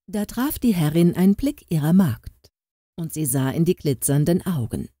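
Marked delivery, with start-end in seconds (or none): none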